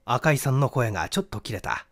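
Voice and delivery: ordinary delivery, nothing marked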